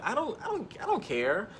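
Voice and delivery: retold outburst, pitched high; high-pitched, insistent mock tirade; going off. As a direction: re-enact losing patience with a friend. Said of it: "I just went off on her".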